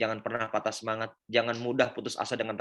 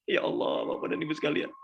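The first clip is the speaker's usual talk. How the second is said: breathy laugh; 5 semitones higher than usual. Real, not acted. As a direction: laugh through the emotion. Laughing after he cried too. (tertawa haru)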